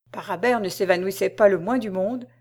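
ordinary delivery, nothing marked

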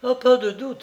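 high-pitched, certain female witness; woman's exclamation. A narrator's reading. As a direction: cry it out high, with conviction. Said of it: "s'écria-t-elle".

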